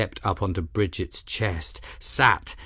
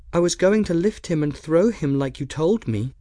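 put-on higher, light voice for a young woman, apologetic; young woman Bridget; 8 semitones higher than usual. A narrator's reading. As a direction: voice Bridget a touch higher and earnest.

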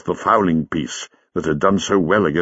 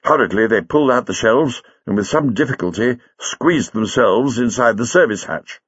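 usual speaking voice

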